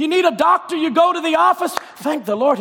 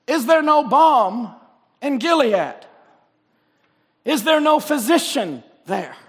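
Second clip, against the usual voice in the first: scripture reading. Acted. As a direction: intone the lament of Jeremiah. Reads Jeremiah aloud.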